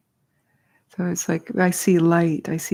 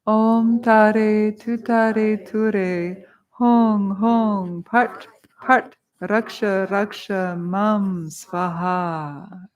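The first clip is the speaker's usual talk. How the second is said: very slow, drawn-out intoning; mantra recitation; 2 semitones higher, much slower than usual. Shown as a demonstration. Teacher saying the first mantra together with students, intoned. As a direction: lead the group in a sustained chant. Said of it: "let's say the first mantra together".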